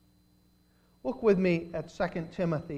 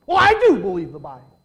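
indignant; 14 dB louder, much faster than usual. Retold or imitated. Lawyer fires back in argument.

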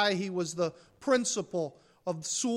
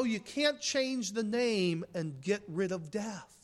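ordinary talk, nothing marked

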